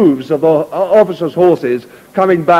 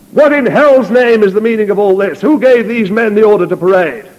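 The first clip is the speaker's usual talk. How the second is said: angry general; 5 semitones higher than usual. Acted. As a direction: bark it at the ranks. General confronts mutinous sergeants.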